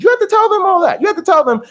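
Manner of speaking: suddenly high, pressing the point home; emphatic insistence. Real, not acted. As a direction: insist hard that they must say it. Stressing advice to students.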